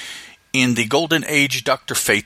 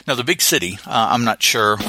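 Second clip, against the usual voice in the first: usual speaking voice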